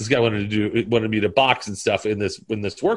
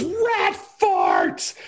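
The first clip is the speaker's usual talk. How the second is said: high-pitched comic cry; mock outburst. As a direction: yelp it out as a film curse. Acts out film character's outburst.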